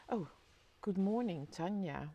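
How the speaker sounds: ordinary talk, nothing marked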